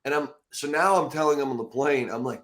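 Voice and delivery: ordinary talk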